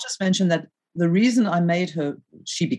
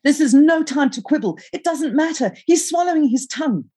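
high-pitched frantic yelling; urgent, exasperated high cry; panicked urgency; 11 semitones higher, 3 dB louder than usual. A narrator's reading. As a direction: yell it high at a sister in an emergency. Screamed reply in an emergency.

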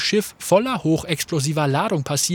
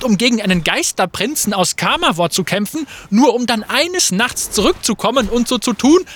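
higher, louder, indignant retort; indignant argument; 5 semitones higher, 4 dB louder than usual. Acted. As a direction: hurl the accusation back at him. Fate quarreling with Graves.

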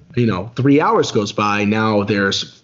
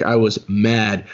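usual speaking voice

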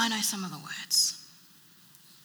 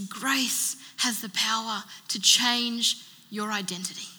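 usual speaking voice

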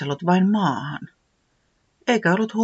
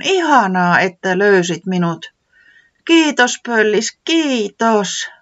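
high, louder grateful thanks; much higher, eager gratitude; relieved thanks; 6 semitones higher, 6 dB louder, slower than usual. Acted. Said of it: "selitti helpottuneena".

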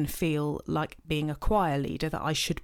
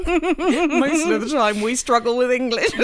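slow, laughing and loud; 7 semitones higher, 9 dB louder, slower than usual. Real, not acted. Joking to her friend about languages, laughing. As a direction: drag it out through laughter.

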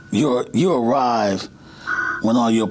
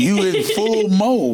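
hyped up; 9 semitones higher, slower than usual. Real, not acted. Coach hyped, full mode.

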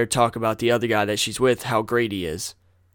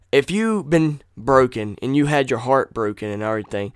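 usual speaking voice

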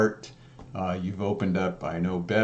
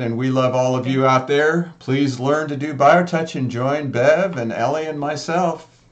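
loud, upbeat promo read; closing show announcement; announcer sign-off; 5 semitones higher, 7 dB louder than usual. Acted. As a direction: pitch the outro to listeners with energy. Host sign-off.

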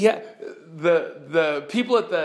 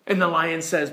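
ordinary delivery

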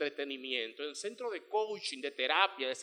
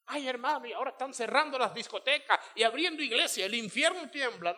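mock excited believer; 7 semitones higher, 4 dB louder than usual. Mocking an overexcited churchgoer.

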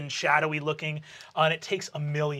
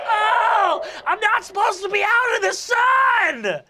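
high, loud panicked ghost yelp; shrieking ghost; 7 dB louder, slower than usual. Acted. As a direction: wail like a burning spirit. Ghost shrieks when burned.

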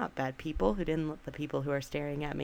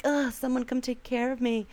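put-on whiny kid plea; 8 semitones higher than usual.

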